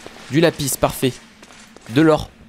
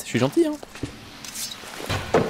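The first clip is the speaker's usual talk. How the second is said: mock sweet whisper; 11 semitones higher, 9 dB quieter, much slower than usual. Mock-kind aside about the skeleton.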